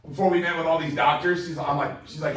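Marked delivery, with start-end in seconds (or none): none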